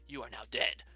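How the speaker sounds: low, hushed villain voice. Pretend. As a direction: deliver the kill like a cartoon villain. Villain verdict on victim.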